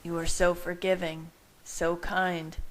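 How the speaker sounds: usual speaking voice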